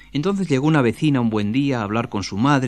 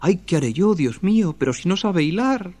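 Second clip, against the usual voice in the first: higher, fretful worried lament; frightened; 7 semitones higher than usual. Acted. Mother lamenting her daughter cannot spin, frightened. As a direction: wring your hands and plead to God. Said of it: "toda asustada".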